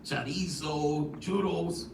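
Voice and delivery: slow, drawn-out Spanish accent on food names. Shown as a demonstration. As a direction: savor each dish in an attempted Spanish accent. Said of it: "trying to do my Spanish accent".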